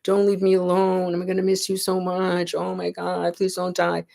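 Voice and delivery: high, loud pleading that fades toward pitiful begging; hammed-up relatives begging someone not to leave; imitating a grieving family wailing at the shore; mock wailing plea. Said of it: "crying and wailing and rending of garments and screaming".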